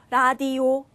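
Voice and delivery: 라디오 as even Korean "ra-di-o"; Koreanized 라디오, level tune; Korean pronunciation. Shown as a demonstration. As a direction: read 라디오 syllable by syllable with Korean r. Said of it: "우리말로는 라디오지".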